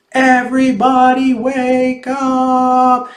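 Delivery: high, slow, drawn-out bellow. Acted. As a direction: stretch every word into a huge wake-up call. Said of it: "took a deep breath and bellowed".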